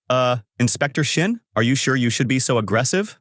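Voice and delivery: usual speaking voice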